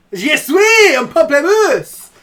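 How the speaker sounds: put-on loud, high, drawn-out French accent; French impression. Streamer doing a French impression, booming mock French. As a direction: proclaim it in over-the-top French. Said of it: "up for a bit of a French impression".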